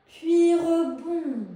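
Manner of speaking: loud, slow rebound cue; loudly called, elongated exercise cue; drawn-out movement cue. Real, not acted. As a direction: stretch the words out along with the bounce.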